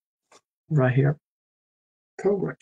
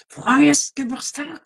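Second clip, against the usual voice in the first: slow, high, hammed-up German greeting; mock a scary German birthday wish; aggressive German; 10 semitones higher than usual. Streamer imagining a German stranger greeting you, hammed-up menace. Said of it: "try to imagine".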